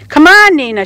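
put-on child calling. Girl under the table calls grandma to join, high eager call. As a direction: call out eagerly to come hide.

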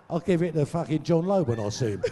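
quick, laughing through the line; chuckling at his own prank. Retold or imitated. Telling the crowd his prank, laughing quickly.

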